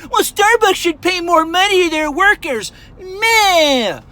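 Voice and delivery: high whiny mocking voice of critics. Mocking the commenters who blame Starbucks, in a high whiny voice. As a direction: sneer it like a whiny objector.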